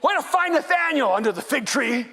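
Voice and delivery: high loud mock congratulation; mock Jesus praise. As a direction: cheer Philip sarcastically.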